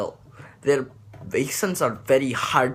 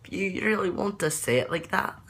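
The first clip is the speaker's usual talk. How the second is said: lighter, more English Scottish accent, pitched well up; David Tennant; 9 semitones higher than usual. Shown as a demonstration. Teaching viewers a David Tennant sound, high Scottish accent. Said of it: "David Tennant sounds like".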